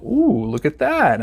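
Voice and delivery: a lightly voiced leering admirer; admiring ooh. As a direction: savour the sight, drawn out. Man reacting to pretty girl.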